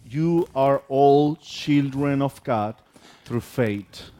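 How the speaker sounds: ordinary talk, nothing marked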